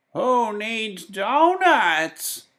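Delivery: stretched, high shouted question. Acted. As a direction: call it out like a sad old woman on her doorstep. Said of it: "she shouted".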